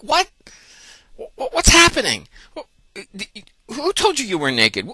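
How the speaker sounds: high, stammering bewilderment; hammed-up flustered astonishment; surprised God. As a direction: stumble over the words in high-pitched mock shock. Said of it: "he's, he's surprised".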